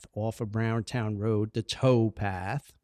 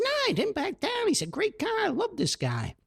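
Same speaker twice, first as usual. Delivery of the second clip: put-on young enthusiasm. Young Paulie enthusing to father.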